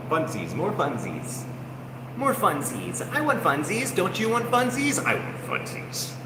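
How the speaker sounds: put-on high pleading baby voice; whiny child. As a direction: beg for funsies like a spoiled toddler. Childish whining for funsies.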